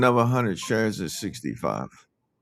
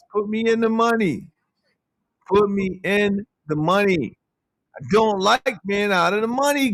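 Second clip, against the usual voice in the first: higher-pitched, complaining plea; whiny complaint; 7 semitones higher than usual. Real, not acted. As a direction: beg to be put in the money. Complaining about being out of money.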